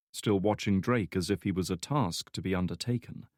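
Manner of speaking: usual speaking voice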